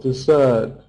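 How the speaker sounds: ordinary talk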